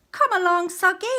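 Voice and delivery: put-on high, loud boyish call; Jack calling out. A narrator's reading. Jack calling Soggy from the balcony, bright and loud. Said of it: "said Jack".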